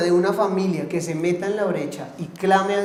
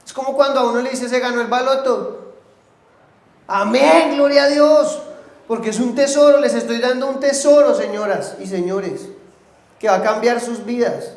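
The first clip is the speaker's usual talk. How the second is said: loud, higher, driving sermon voice; fiery preaching; 4 semitones higher, 4 dB louder than usual. Acted. Pastor exhorting the congregation to pray, fiery preaching. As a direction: preach it full force to the church.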